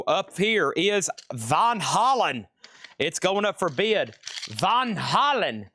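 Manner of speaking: mock German accent, slow and drawn-out. Seller announcing the Van Halen auction in mock German accent. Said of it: "my German accent there".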